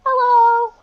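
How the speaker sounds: high, loud bright hello; cheerful shout. Acted. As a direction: call up cheerfully to the tall clerk. Halfling greets clerk loudly.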